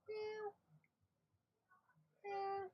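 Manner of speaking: very high, soft mewing; voicing the cat. Owner mews for the cat to greet viewers, very high and soft. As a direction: mew like a tiny kitten.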